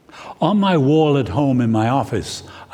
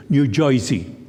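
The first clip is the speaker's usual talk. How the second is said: New Jersey accent; 3 semitones lower than usual. Shown as a demonstration. Preacher mimicking locals' name for their state, New Jersey accent. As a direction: say Jersey as "Joisey".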